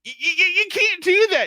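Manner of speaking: put-on stammering, high shouted protest in a boy's thick accent; imitating a nephew in loud denial, thick accent; thick young-boy accent. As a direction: sputter and yell like a kid with a thick accent. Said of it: "like, the thickest young boy accent".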